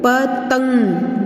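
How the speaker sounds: पतंग slowly, in two parts, प then तंग; slow पतंग hummed through the nose; nasal anusvar demo. Shown as a demonstration. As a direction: draw out the nasal ending of पतंग. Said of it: "जैसे मैंने किया है पतंग".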